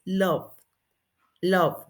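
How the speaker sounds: LOVE ending on V, silent E; final V sound. Shown as a demonstration. Teacher showing the final sound, final V sound. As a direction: stop LOVE on the lip consonant. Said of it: "el sonido es de la B".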